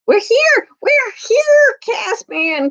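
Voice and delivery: put-on high, eager call of children; excited cry. A narrator's reading. Lucy and Edmund calling out to Caspian, high and excited. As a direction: shout out with joy at being found. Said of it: "cried Lucy and Edmund together".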